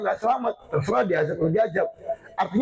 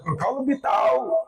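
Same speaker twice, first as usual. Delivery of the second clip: high, lilting exclamation; lilting tune; 9 semitones higher than usual. Retold or imitated. Preacher retells the Prophet's reply to Jibril, high lilting tune. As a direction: swing the phrase up high. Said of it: "Dari Jibril lagunya".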